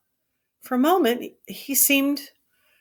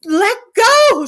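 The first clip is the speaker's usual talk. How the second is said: shrill, much higher, loud outcry; cry out; 14 semitones higher, 9 dB louder than usual. A narrator's reading. Curly's wife crying out for Lenny to let go. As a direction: yell it in alarm. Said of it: "she cried".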